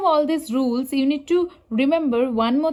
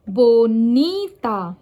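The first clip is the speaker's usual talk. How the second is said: bonita slowly, with a loud, drawn-out NI; stress on second syllable; 4 dB louder, much slower than usual. Shown as a demonstration. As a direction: stretch and punch the NI in bonita. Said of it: "one syllable of a word is usually louder".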